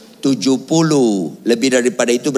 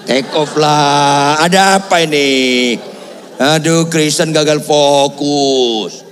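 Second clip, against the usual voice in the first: loud, long drawn-out cries; mock exasperation; 2 semitones higher, 4 dB louder, slower than usual. Real, not acted. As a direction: stretch the endings in comic dismay. Mock exasperation at laughing congregation.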